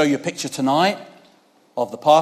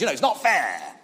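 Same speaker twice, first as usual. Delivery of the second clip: ordinary talk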